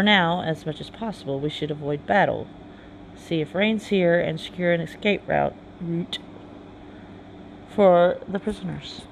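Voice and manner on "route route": "route" said first to rhyme with out, then as root; route pronounced two ways. Shown as a demonstration. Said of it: "however y'all wanna pronounce that".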